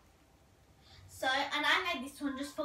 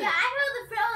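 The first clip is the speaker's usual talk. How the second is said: excited; 6 semitones higher, 9 dB louder, faster than usual. Real, not acted. Echoing friend, excited.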